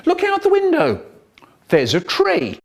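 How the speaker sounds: mock excited mum. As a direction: sell the window like it's a treat, high and bright. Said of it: "Mum tries to be exciting again".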